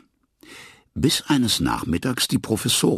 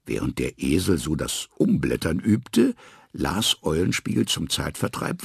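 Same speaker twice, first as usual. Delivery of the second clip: usual speaking voice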